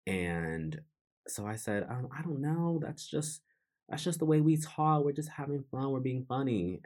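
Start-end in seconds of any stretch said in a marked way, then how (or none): 1.9-6.8 high-pitched, acted-out excuse; defensive brush-off. Telling mom it's just how friends talk, defensive brush-off. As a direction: retell it as a lightly defensive protest, pitched up.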